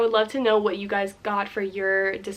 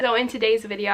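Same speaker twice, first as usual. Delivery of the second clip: usual speaking voice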